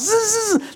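high buzzing "zzz" of cars screeching in; zooming car noises. Retold or imitated. Pastor mimicking police cars pulling up at his house, zooming buzz. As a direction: imitate cars zipping up with sharp buzzes.

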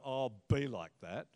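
low, offhand "oh, BE like that"; dismissive "be like that". Shown as a demonstration. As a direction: brush someone off with "be like that". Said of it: "Not, you know".

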